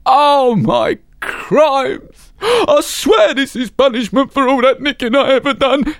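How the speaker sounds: high, slow, anguished cockney mother; mother's high, hammed-up lament; wailing mother. A narrator's reading. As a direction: wail it out like a guilty thief. Mother wails at the news.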